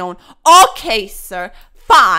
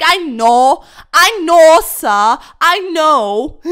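loud admission; 3 semitones higher, 10 dB louder, much slower than usual. Real, not acted. Admitting anger, loud.

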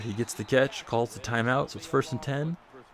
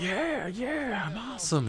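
cheering player; 11 semitones higher, 3 dB quieter, slower than usual. Retold or imitated. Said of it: "the guys all run out to the field like".

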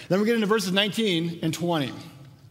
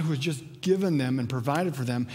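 ordinary talk, nothing marked

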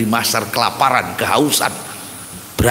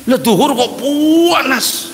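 mock complaint at the heat; 12 semitones higher, much slower than usual. Retold complaint about the heat.

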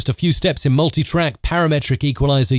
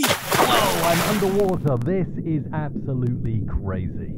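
underwater; 5 semitones higher, 4 dB quieter than usual. Shown as a demonstration. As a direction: sound like you're underwater. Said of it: "muffle my voice and make me sound like I'm underwater".

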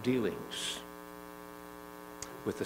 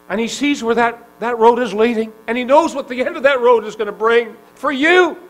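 higher and louder, building fervor; preaching at full force; 6 semitones higher, 11 dB louder than usual. Acted. Preacher pleading with the listener, rising to full force. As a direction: drive it home to the congregation.

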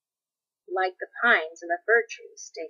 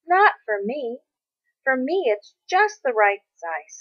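eager insistence; 5 semitones higher, 5 dB louder than usual. Acted. Boy answering his father.

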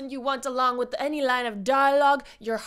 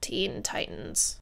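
usual speaking voice

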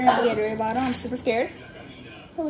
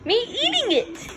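mock dramatic; 12 semitones higher than usual. Dramatic buildup before eating spicy ramen.

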